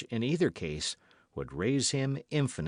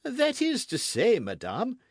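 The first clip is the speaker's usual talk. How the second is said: high, loud hedging; flustered king; 13 semitones higher, 5 dB louder than usual. A narrator's reading. King hedging before the queen, flustered. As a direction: stall for a moment.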